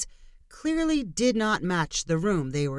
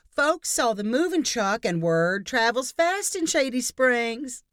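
gushing neighbor; 7 semitones higher, slower than usual. Acted.

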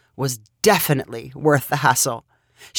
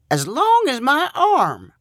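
high, slow, proud exclaim; excited cry; 9 semitones higher, slower than usual. A narrator's reading. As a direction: boast it out high. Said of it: "cried Milford".

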